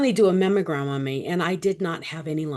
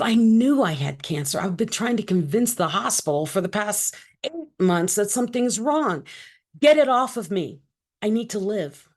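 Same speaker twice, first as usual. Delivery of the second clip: raised, emphatic insistence; higher, louder, acting out desperate insistence; retold urgent demand; 4 semitones higher, 4 dB louder than usual. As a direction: plead forcefully to be heard at last. Demanding treatment from the doctor.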